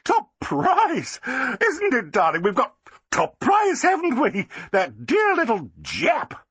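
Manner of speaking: high, gushing society lady; Flossie, delighted. A narrator's reading. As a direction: crow with triumph, slowing at the end. Flossie gushing over the sale.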